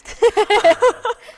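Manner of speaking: high, loud burst of laughter. Real, not acted. Guest laughs after showing Australian slang, loud high laugh.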